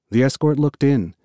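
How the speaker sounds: plain reading voice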